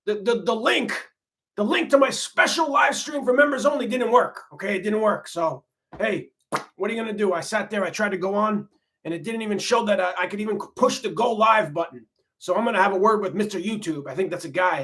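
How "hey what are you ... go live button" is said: usual speaking voice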